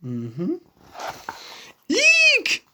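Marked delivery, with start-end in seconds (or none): 1.9-2.7 a sudden panicked eek; high squeak of alarm. Acted. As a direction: squeal in fright. Page turns, character panics.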